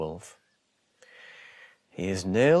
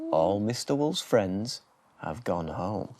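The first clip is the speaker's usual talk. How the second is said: plain reading voice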